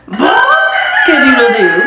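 slow, loud shouted crow; rooster crow. Acted. Rudy crowing at six in the evening, shouted. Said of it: "At six P M".

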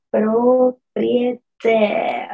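Propriétaire very slow, force on the final syllable, e silent; slow stressed demo. Shown as a demonstration. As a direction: draw out Propriétaire and push the ending. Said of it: "tenemos que acentuarle aquí fuerza". (Propriétaire muy lento, con fuerza en la sílaba final)

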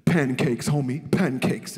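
a low sly aside, imitating Paul Mooney; Mooney's low murmur. Mooney leaning over backstage to tease, low sly mock aside. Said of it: "he leans over and he says".

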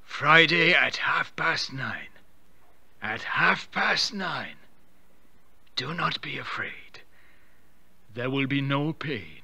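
slow, hollow voice from beyond the grave; drawn-out ghostly warning from the other world; dead husband's voice summoning his wife; sepulchral ghost voice. Acted. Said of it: "spoke from the other world in the same sepulchral tones".

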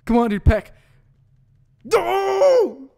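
high loud yell; panicked shout. Real, not acted. Gamer watching his pokemon faint, screaming high. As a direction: scream in despair at the screen.

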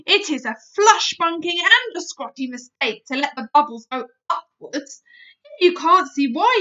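affronted big friendly giant; ranting giant. Acted. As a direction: rant at her, affronted. BFG's long indignant rant.

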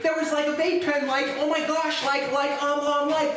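mocking the chief. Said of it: "She said, and I quote".

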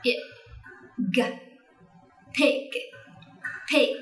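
"pig" with puffed p, short i, light final g; standard English pronunciation. Shown as a demonstration. Teacher modelling standard "pig" for parents and children, English pronunciation. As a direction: model "pig" crisply in English, each sound complete. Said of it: "cách phát âm chuẩn của từ này".

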